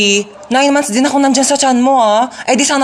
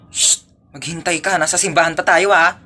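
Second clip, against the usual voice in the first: ordinary talk, nothing marked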